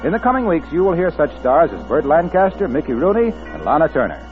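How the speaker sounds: ordinary delivery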